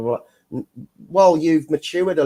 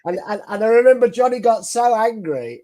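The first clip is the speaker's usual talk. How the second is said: usual speaking voice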